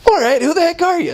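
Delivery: mock exasperation at the door; exasperated woman. Woman at the door loses patience with the missionaries, high and fed-up. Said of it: "she didn't say the word heck".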